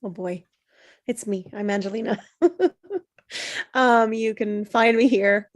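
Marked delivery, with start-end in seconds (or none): none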